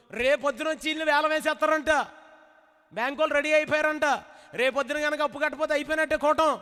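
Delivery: high, fast, anxious scolding; worried neighbour. Retold or imitated. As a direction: rattle off the bad news to shake him. Neighbour warns of auction.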